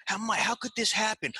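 high, whiny panicked friend; upset friend. Retold or imitated. Friend calling about lost picks, high whiny disbelief. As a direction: play the friend who lost his bets. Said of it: "He's like".

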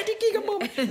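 put-on very high, whimpering child voice; teacher voicing a sobbing girl blaming the boys; little girl. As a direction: complain like a tearful small girl. Girl quoted.

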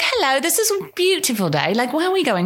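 high-pitched put-on English accent. Honeymooner chatting in a taxi, mock English accent. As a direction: play the fake Brit, hammed-up English accent. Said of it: "only spoke with an English accent".

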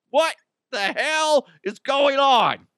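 high, loud, bewildered yelling; a confused person waking at their own funeral, yelling in alarm; waking corpse freaking out. Retold or imitated. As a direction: shriek like you just sat up in a coffin. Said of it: "They're like".

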